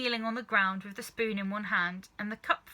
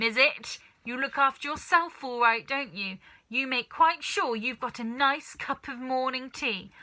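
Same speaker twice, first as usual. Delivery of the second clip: put-on loud, higher, accusing old woman's voice; ranting grandma; 4 semitones higher, 6 dB louder than usual. Grandma catching George with tea and scolding him loudly. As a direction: tear into the boy like a spiteful old hag.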